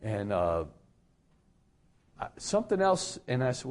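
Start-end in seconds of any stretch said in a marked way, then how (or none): none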